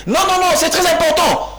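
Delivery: very high, forceful no, no, no; agitated insistence. Real, not acted. Pundit insisting to the host, high agitated protest. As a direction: insist hard, voice shooting up.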